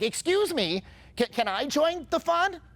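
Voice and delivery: high, slow, pleading whine; needy mock voice. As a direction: beg to join in like a needy outsider. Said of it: "if you said it like that".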